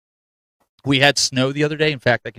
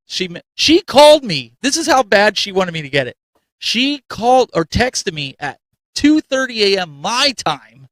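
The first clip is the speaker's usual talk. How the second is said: higher and louder, building indignation; excited; 7 semitones higher, 4 dB louder than usual. Real, not acted. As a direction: tell it with mock outrage. Excited retelling of late call.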